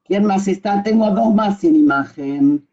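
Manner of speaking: usual speaking voice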